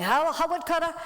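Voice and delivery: high, pleading retold call. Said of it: "So I'm saying".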